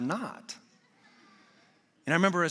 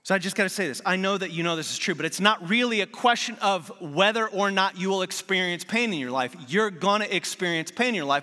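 louder, higher, driving sermon voice; preaching; 4 semitones higher, 4 dB louder than usual. Acted. Pastor pressing the point to his congregation, preaching. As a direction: preach it with conviction. Preaching builds to full force.

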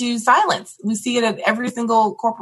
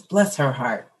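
low, slow Southern "Bless her heart"; a light Southern grandmother's put-down; 7 semitones lower, slower than usual. Pretend.

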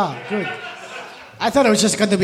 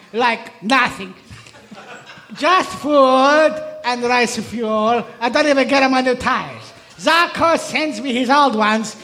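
Danilo Petrucci. Acted. Italian racer accepting a wedding invitation, hammed-up impersonation. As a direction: play a hungry Italian, lingering on key words.

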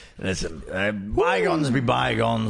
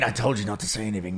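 usual speaking voice

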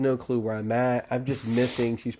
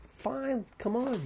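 high, soft voice giving in; light, high mimicked "fine"; high retold consent; 11 semitones higher, 10 dB quieter, much slower than usual. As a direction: relent in a small high voice. Queens grudgingly agree in the story.

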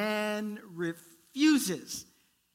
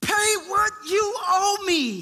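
high, loud, slow furious demand; demanding shout; 9 semitones higher, 6 dB louder, slower than usual. Acted. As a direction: bark the debt at him. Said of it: "he demanded".

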